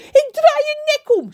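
very high, loud furious scream; furious shout. Acted. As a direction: scream the threat at the crow. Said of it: "schreeuwt hij". (woedend geschreeuw)

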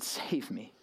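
hushed, whispered retelling of a plea; soft, voiceless entreaty. Retold or imitated. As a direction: whisper it as a humble cry for help.

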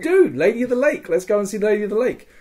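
rally everyone with eager, rising excitement; excited rallying. Retold or imitated. Group calling each other to see the Lady, excited.

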